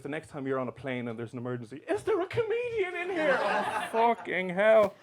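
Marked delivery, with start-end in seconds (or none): 1.9-3.4 much higher, panicked call over the cabin; lightly hammed-up cabin announcement; mock flight attendant. 3.4-4.9 mock dread. Comedian dreading the call.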